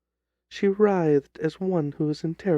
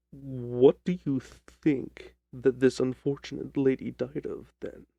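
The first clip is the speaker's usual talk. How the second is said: lower, measured detective voice; a calm, lower Holmes cutting in; 5 semitones lower than usual. Acted. Holmes asks slowly.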